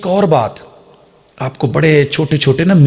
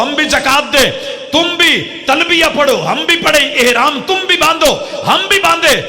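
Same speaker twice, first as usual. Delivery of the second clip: rising higher and higher, full-force sermon; preacher climbing to a fervent high pitch; preaching crescendo; 6 semitones higher than usual. Acted. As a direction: build the list to a fiery peak. Preaching builds to climax.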